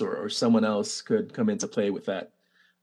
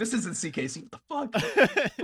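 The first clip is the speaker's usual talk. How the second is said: quoted confused listener; 10 semitones higher, 4 dB quieter than usual. Retold or imitated. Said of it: "be like".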